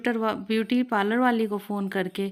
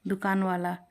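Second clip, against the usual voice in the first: ordinary delivery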